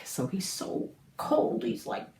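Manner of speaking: mock shivering cold voice. Shown as a demonstration. Teacher demonstrates Scrooge shivering, cold and tight. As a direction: show how cold Scrooge is, teeth chattering. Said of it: "like this".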